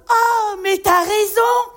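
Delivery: hammed-up cheerful hosts offering mocktails; thrilled hosts. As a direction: gush like proud teetotal hosts. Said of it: "ils m'ont dit tout contents".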